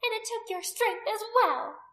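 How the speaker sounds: high, loud scornful jab; contemptuous. A narrator's reading. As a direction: sneer it across the crowd. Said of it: "Anomia said contemptuously".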